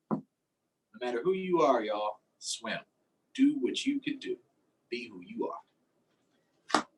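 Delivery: lively, sing-song picture-book reading; reading to children. Acted. Teacher reading a fish book to her class, lively storytime voice. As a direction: read it out like storytime for little kids.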